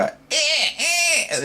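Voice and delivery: very high, very slow crow-like cry; crow impression. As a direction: caw it out like a crow in the cot. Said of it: "And then they go".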